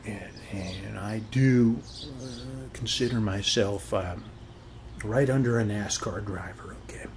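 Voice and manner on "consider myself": ordinary delivery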